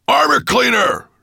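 very high, loud monster roar. A narrator's reading. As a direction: roar for your servant. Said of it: "roared Nettlebrand".

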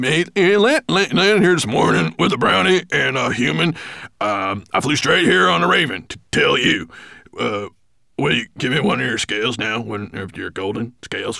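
high, loud, babbling dwarf voice; flustered dwarf. A narrator's reading. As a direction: stumble eagerly through the report. Flustered dwarf babbles his report.

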